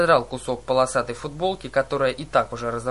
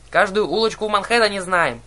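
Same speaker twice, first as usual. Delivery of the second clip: higher, louder boasting; boastful; 6 semitones higher, 6 dB louder than usual. A narrator's reading. Old cab driver showing off to passengers, boastful. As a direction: brag loudly about what you know. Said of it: "схвастливо заявила Тревога".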